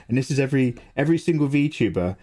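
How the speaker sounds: ordinary delivery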